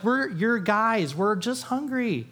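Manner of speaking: pleading disciples. Retold or imitated. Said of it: "The disciples are like".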